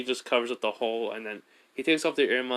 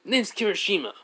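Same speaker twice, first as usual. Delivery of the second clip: higher, bubbly self-introduction; cheery higher Kirishima; 6 semitones higher than usual. Retold or imitated. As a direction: pitch up, eager and friendly. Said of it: "Kirishima was like".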